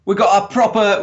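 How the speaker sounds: put-on posh English accent, lightly done; mock posh English accent. Friends hamming posh English accents for the camera. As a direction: play at sounding proper English. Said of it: "our proper English accents on".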